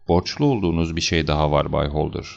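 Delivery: ordinary delivery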